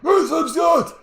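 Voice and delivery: loud, high mock coach shout; mock coach yell. Imitating coach Mitch yelling at his players, mock coach shout. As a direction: bark it like a sideline coach. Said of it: "Mitch would be like".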